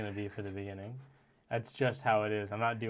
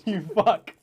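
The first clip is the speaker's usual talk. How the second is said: playful yell; 8 semitones higher, 11 dB louder than usual. Real, not acted. Loud playful insult.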